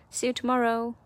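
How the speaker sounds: usual speaking voice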